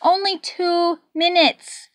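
loud, high, near-shouted countdown; frantic, slowing on Crash; desperate maestro calling for his drummer; panicked conductor. A narrator's reading.

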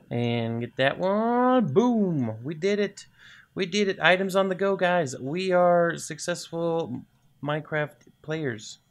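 loud, high celebration; excited gamer's victory call, drawn out at the end; triumphant. Real, not acted. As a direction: cheer the win to your viewers. Celebrates finishing the build.

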